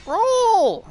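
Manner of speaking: high, loud yell of roll; urgent shout. Real, not acted. Gamer yelling at his character to dodge, loud. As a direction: bark it mid-fight.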